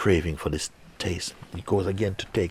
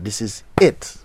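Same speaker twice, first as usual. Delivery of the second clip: higher, louder thrill of finding it; thrilled discovery; 4 semitones higher, 5 dB louder than usual. Acted. The seeker finds the nectar, thrilled. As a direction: burst out with joy at the find.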